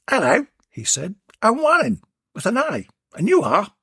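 high, chirpy tradesman greeting; cheeky introduction; chirpy workman at the door; Warren's voice. Acted.

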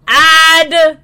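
loud high call of ad. Real, not acted. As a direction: announce it loudly. Calls out the ad break.

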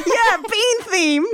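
drawn-out laughing delight. Retold or imitated. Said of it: "And I'm like".

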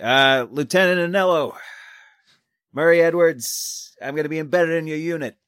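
high, projected introduction; calling out in character. Acted. Reporter introducing himself to the new lieutenant, calling out. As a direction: raise your voice to introduce yourself.

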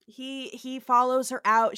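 normal talking voice

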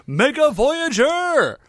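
high, drawn-out hero-robot announcement; slow, soaring mock-heroic call; triumphant reveal. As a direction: proclaim the name like a cartoon reveal. Acted robot name reveal.

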